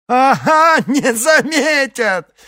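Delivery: shrill, slow laughing mockery; hysterical laughter. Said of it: "истерически рассмеялся Перец".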